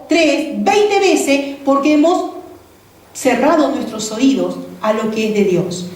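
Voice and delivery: usual speaking voice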